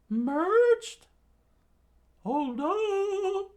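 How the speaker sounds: very high squeaky shock; comic high shock; disbelief. Acted. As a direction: squeak out surprise. Disbelief, character.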